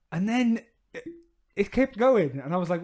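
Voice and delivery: ordinary delivery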